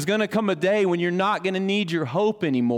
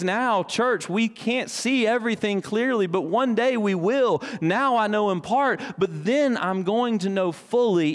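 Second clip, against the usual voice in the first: higher, impassioned preaching; 7 semitones higher than usual. Acted. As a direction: lift the church with conviction. Preaching at full force.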